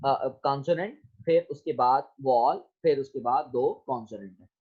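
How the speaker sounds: normal talking voice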